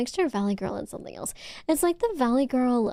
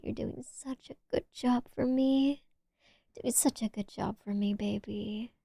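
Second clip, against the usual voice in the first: softer and softer tender murmur; soft praise; 5 dB quieter than usual. Shown as a demonstration. Praising a viewer who likes being praised, softly. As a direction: soothe the listener with soft praise. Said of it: "praise kind of gets to you".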